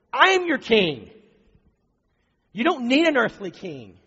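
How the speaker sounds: high, loud plea in God's voice; impassioned pleading. Acted. God pleading with his people through Samuel, loud impassioned plea. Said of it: "God was pleading with them".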